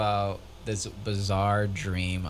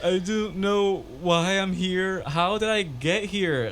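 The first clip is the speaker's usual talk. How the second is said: high slow mock-sobbing apology; mock bawling; 10 semitones higher, slower than usual. Lost extra to director, mock sobbing slowly. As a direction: blubber it out slowly, half joking. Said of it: "I just start bawling, crying".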